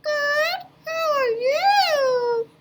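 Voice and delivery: high, slow, squeaky puppet greeting; a cheerful little puppet says hello; high cartoon puppet voice calling out; puppet Leo voice.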